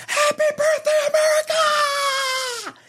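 piercing falsetto scream with a long drawn-out ending; top-of-lungs falsetto. Shown as a demonstration. Showing co-host the rules, top-of-lungs falsetto scream. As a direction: shriek it high and stretch the last word. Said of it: "in the top of your lungs".

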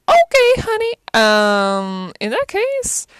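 high, loud mock-startled reaction; taken aback. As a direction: react in an exaggerated high-pitched voice. Reacting aghast to manipulation lesson.

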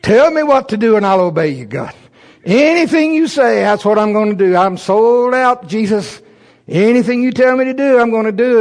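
high, loud, overeager pleading; playing his younger self all in for God; high-pitched gushing promise to obey; eager prayer. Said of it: "And I've been praying".

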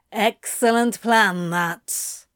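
sneering mock approval; condescending sneer. A narrator's reading. Crowley belittles Zira's plan, a condescending sneer. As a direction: praise it with obvious condescension. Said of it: "Crowley sneered with peak condescension".

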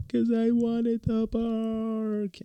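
very high, slow mock whimper of a little girl; imitating crying child. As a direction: wail it like a sulking toddler. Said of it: "my daughter starts to cry".

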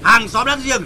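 high, loud grumbling dad; grumpy father. Acted. Father grumbles at the girl shouting outside, high and loud. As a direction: fuss about the noise outside.

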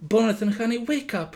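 a hint of trembling fear. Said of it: "Martha's voice is trembling".

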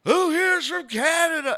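high, loud stand-up opener; crowd-rousing shout. Retold or imitated. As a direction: imitate a host hyping a big theater. Retold shout to theater crowd.

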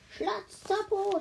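high, slow cry; high drawn-out curse shouted too late; mock scream. A narrator's reading. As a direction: shriek the curse. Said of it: "schrie sie".

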